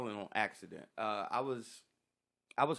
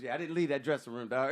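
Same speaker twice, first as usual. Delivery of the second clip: ordinary delivery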